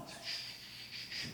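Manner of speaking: unvoiced hush; shushing. Owner hushing his parrot, soft shush. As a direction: hiss them quiet.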